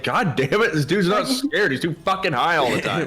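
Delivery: annoyed ghost. Pretend. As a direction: grumble like a fed-up ghost. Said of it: "They're just like".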